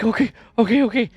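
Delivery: high, flustered string of okays; imitated freaking out. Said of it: "and he's freaking out".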